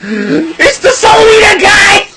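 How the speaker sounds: very high, slow, shouted exclamation; the wrestler spotting him, high slow mock shout; excited shout. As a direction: yell it out in a put-on voice.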